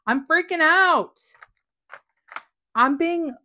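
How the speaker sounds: loud, high, thrilled outburst; real freak-out. Real, not acted. Crafter telling viewers she's having too much fun, real excited freak-out. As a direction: burst out, then ease down slightly. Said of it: "authentically freaked out right now".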